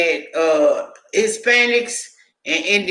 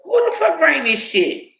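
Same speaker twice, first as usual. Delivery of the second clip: incredulous outcry; 8 semitones higher than usual. Retold or imitated. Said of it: "I was like".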